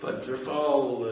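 normal talking voice

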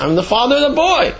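father bristling at the question; indignant. Retold or imitated. Father answers the doctor, indignant.

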